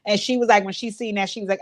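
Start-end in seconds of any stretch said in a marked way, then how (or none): none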